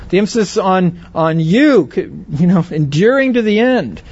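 usual speaking voice

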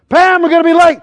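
high, loud call to the wife; re-enacted harried husband's shout; acted impatient yell. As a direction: holler through the house that time is up. Re-enacts hurrying family to church.

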